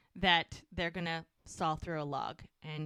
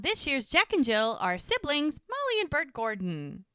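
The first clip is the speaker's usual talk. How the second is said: Muriel announcing; 5 semitones higher, 4 dB louder than usual. Retold or imitated. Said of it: "Muriel's in the background".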